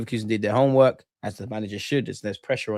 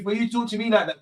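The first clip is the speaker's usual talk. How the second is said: usual speaking voice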